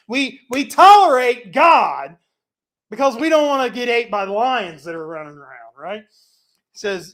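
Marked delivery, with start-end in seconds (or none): 0.1-5.7 mocking the nations. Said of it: "He's saying".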